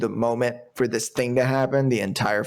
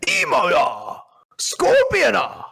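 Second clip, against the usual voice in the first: put-on higher, louder shout in a Japanese accent, stretching Scorpiona slowly; Scorpion's battle cry; 12 semitones higher, 5 dB louder, much slower than usual. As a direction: yell it like the ninja in a Japanese accent. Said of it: "I'm gonna try real hard not to screw this one up".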